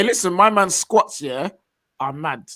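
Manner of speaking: ordinary delivery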